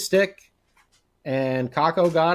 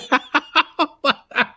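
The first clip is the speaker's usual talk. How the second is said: laughing; 10 semitones higher, 4 dB louder than usual. Real, not acted. Laughing at group chat.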